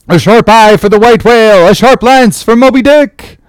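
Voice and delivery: high, loud battle cry; seamen's shout. Acted. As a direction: chant it like an excited crew. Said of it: "shouted the harpooneers and seamen".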